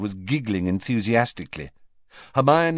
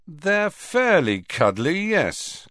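slow, higher-pitched concession; conceding; 8 semitones higher, slower than usual. A narrator's reading. Fred admits to Ginny the pets are cuddly, conceding. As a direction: admit it slowly, granting the point. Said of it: "conceded Fred".

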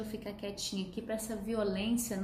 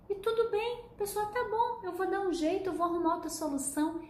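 much higher, bubbling with excitement; delighted client; 7 semitones higher than usual. Retold or imitated. Client telling Kelly her limit worked, excited and much higher. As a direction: gush like someone amazed it worked. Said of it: "a pessoa fala assim".

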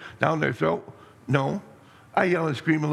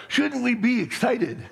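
rousing preacher; 6 semitones higher than usual. Real, not acted. Pastor rouses the congregation.

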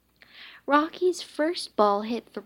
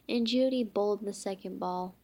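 ordinary delivery, nothing marked

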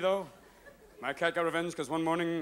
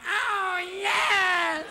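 very high yowling, drawn-out cries; cat in heat; 13 semitones higher, much slower than usual. Acted. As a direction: wail it high and slow like a mating cat. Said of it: "Sitting under there going".

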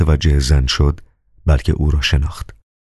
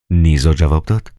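ordinary delivery, nothing marked

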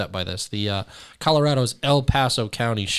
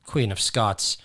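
normal talking voice

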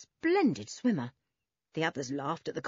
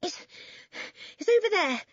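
breathless, higher-pitched gasps; panting; 13 semitones higher than usual. A narrator's reading. Boy pointing out the pool to the others, panting. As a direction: get it out in puffs, out of breath. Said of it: "he panted".

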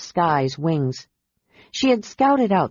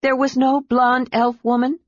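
higher, pointed question; sharp question; 6 semitones higher than usual. A narrator's reading. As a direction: cut in sharply on the dragon. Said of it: "asked him sharply".

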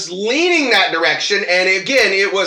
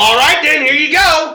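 higher, louder jaunty retort; mock cheerful reply; 8 semitones higher, 4 dB louder than usual. Retelling his answer to her request, cheeky and louder. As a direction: toss it off with a cheeky flourish.

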